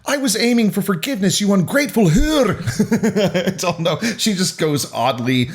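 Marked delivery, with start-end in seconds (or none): 0.0-2.6 high shouted line turning Scottish at the end; quick, hammed-up Scottish outburst; Scottish accent. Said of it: "insultingly Scottish at the end of it". 2.6-3.4 high-pitched giggle; real amused laugh. Real, not acted. Cracking up at own accent.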